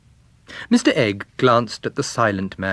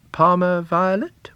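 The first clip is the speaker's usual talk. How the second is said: lightly voiced higher, slow query; a slow, lifted question; Monty; 10 semitones higher, 3 dB louder, slower than usual. A narrator's reading. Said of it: "Monty said".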